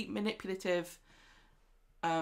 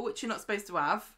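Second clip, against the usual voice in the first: ordinary talk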